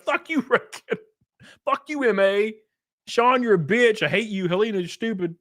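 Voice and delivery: high, whiny mock tirade; hammed-up petty rant; yelling at chat. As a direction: fling insults in a high strained voice. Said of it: "this guy yelling at his chat".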